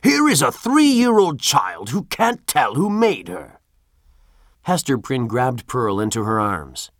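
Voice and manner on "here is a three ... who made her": high, slow, louder outraged voice; scandalised old official; indignant governor. Acted.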